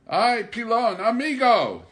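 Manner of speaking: loud, high call across the woods; calling out to his old friend; cried out. Acted. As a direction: hail him from a distance, arms wide. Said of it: "Danny cried".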